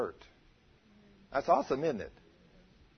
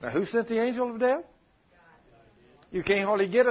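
usual speaking voice

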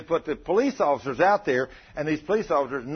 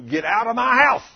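retold scream; 9 semitones higher, 8 dB louder than usual. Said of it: "she started screaming at me".

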